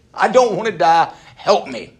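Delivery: loud, emphatic plea, dropping at the end; pleading. Acted. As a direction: beg for your life out loud. Continues acted appeal for help.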